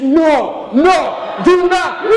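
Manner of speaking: mock panicked shout at his wife; urgent shout. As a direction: stop her before she reacts.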